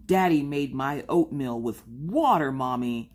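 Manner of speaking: upset, pouty little kid imitation; Maggie. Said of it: "Maggie is all upset ... this pouty look".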